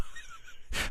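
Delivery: quiet unvoiced chuckle; breathy stifled laugh. Real, not acted. Vlogger reading the giraffe story, stifled laugh. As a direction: let a soft laugh slip.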